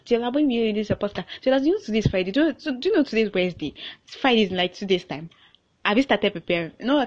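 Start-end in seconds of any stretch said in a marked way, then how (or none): 0.0-3.7 a hint of a pushy friend, higher and fast; mock friend nagging. Friend pushing her to release the podcast, lightly mocked. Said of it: "Edo is always pushing me".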